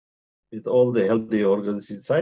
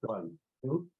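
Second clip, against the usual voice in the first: much softer counting, fading away; counting while clapping; 3 semitones lower, 12 dB quieter than usual. Acted. Teacher counting claps for the class, soft and fading. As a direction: keep the count low under the clapping.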